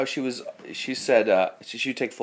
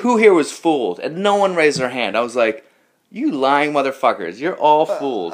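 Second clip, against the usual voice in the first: retold calling-out; 5 semitones higher, 5 dB louder than usual. Calling out the duped class.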